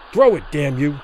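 rasped, forceful order; harsh, pressuring growl; harsh low rasp. A narrator's reading. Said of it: "he rasped in a harsh, low voice".

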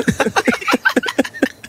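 high fake sobs trailing on; hammed-up wordless bawling; mock crying. Wronged child after accusing parent, mock sobbing. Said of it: "That felt good to cry".

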